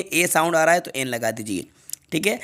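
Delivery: ordinary talk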